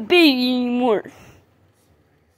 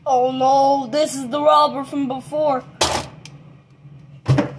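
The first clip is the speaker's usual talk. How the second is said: shark puppet voice; 5 semitones lower, 7 dB quieter than usual. Acted. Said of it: "I'll do the shark puppet voice".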